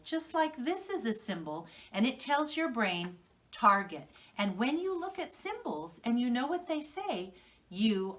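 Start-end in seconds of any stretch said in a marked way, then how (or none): none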